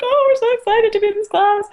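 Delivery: put-on high, loud, gushing teen voice; excited students.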